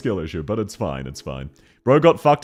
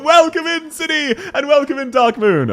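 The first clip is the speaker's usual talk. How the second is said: high sing-out welcome; pitched-up, projected hello; excited greeting; 11 semitones higher, 3 dB louder than usual. Real, not acted. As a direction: hail each arriving viewer in turn. Streamer calling greeting to viewers.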